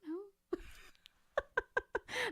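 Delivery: stretched, whimpering I don't know; mock whine. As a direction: draw it out like a helpless whine. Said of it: "Who was in this movie?".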